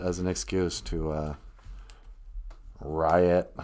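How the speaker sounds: usual speaking voice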